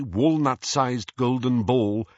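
plain reading voice

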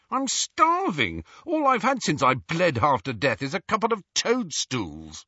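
high-pitched angry grievance; snarling complaint. A narrator's reading. Hungry Ron to Hermione, snarling. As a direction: complain bitterly, pitch well up. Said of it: "he snarled at Hermione".

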